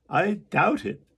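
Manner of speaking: the carpenter doubting out loud. Acted. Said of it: "said the carpenter".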